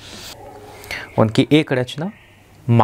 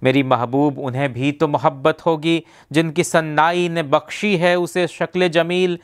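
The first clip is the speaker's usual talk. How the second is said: higher-pitched, measured Urdu verse declamation; poetry recitation; 6 semitones higher than usual. Acted. Reciting Sahir's Taj Mahal poem to a beloved, measured poetic declamation. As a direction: open each stanza high and descend line by line.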